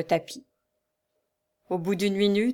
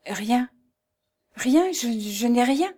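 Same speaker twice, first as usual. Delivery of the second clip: higher, halting, evasive answer; faltering denial; 3 semitones higher, slower than usual. A narrator's reading. Wife hiding her hurt from her husband, faltering denial. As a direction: deny it with hesitant breaks between words.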